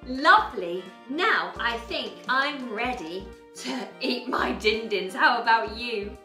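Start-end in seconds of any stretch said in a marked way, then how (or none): none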